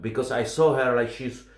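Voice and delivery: usual speaking voice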